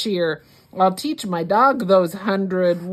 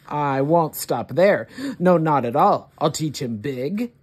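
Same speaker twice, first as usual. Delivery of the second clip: plain reading voice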